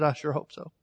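ordinary talk, nothing marked

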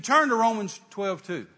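loud, high, slow order; imitating a commanding God, forceful and measured; retold divine command. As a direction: boom out the instruction as God.

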